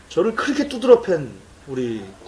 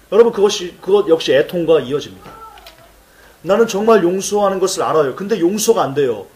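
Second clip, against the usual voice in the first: ordinary talk, nothing marked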